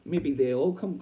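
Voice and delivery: usual speaking voice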